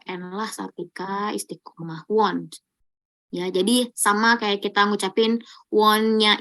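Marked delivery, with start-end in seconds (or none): none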